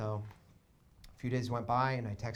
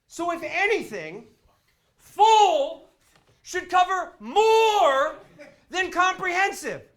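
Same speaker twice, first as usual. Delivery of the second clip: retold indignant rant, building from firm to shouting; exasperated customer building to a high, loud protest; raising his voice; 10 semitones higher, 8 dB louder than usual. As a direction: read it out pointedly, climbing higher and louder. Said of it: "you're raising your voice".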